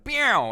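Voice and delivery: mock diva meow; sassy cartoon meow. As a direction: purr out a snooty cat sound like a cartoon intro. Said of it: "like someone doing a intro in a cartoon".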